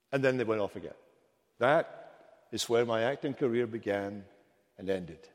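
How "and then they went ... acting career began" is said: ordinary delivery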